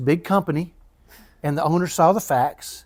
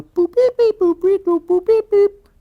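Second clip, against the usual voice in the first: high, loud fax-dial boops and beeps; rapid mock machine tones; imitated dialing beeps; 8 dB louder, slower than usual. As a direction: beep out each keypress, high and punchy.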